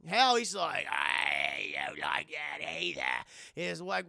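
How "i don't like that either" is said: slow ghost grumble. Retold or imitated. Ghost Bill voice.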